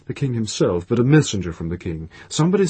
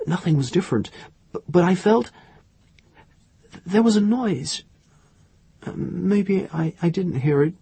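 high, halting, faltering account; groping for words; 8 semitones higher than usual. A narrator's reading. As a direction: stumble and restart as Irene, pitched high. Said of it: "She tried to get the right words".

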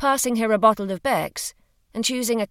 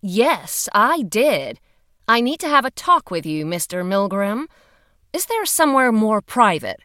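louder, brisk official woman; firm woman's voice; 3 semitones higher, 6 dB louder than usual. Acted. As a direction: speak up like a firm investigator. Female agent speaking firmly.

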